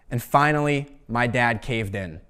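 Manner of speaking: usual speaking voice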